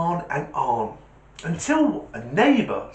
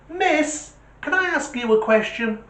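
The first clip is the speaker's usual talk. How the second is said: put-on kid voice; 13 semitones higher than usual. Jimmy voiced in joke.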